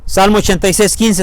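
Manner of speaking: usual speaking voice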